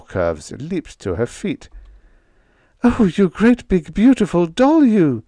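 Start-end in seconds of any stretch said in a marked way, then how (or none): 2.8-5.2 high, loud, excited squeal; yipping fan. A narrator's reading. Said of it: "she yipped".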